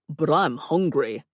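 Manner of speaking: slow, put-on whine of complaint; drawn-out theatrical complaint; complaining character voice. A narrator's reading. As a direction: play a hungry man making a drama of it.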